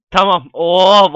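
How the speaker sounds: usual speaking voice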